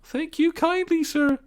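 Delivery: high, slow feeble washerwoman voice. Acted. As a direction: play the frail old washerwoman. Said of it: "said Toad in a feeble voice".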